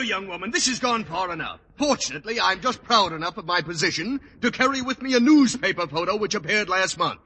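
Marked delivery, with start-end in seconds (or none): none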